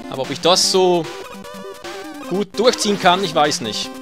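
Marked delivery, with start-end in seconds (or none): none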